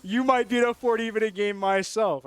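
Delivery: loud, mock-salty retort; a little salty. Caster retorting to coach's teasing about forty-minute games, a little salty. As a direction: fire back a bit salty, half laughing. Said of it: "I was a little salty".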